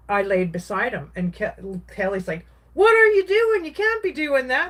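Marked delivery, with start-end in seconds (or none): 2.7-4.7 much higher and louder, shocked protest; alarmed outcry. Retold or imitated. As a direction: brother shouting at him to stop. Brother objects at deathbed.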